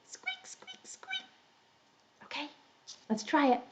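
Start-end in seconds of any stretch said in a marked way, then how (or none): 0.1-1.2 tiny, high mouse squeaking; mouse squeaks. Shown as a demonstration. Teacher shows children the mouse cue, tiny high squeaks. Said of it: "let's do big ears and say".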